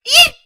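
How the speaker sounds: startled squeak; a sudden startled eep. A narrator's reading. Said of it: "Chrysalis squeaked".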